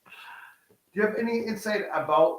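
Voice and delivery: normal talking voice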